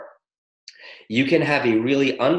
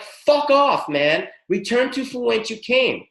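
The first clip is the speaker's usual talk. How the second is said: high, forceful command with balls; defiant; 8 semitones higher than usual. Shown as a demonstration. Telling demons to go back, defiant and commanding. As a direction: order it out and mean it. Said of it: "You gotta get some fucking balls".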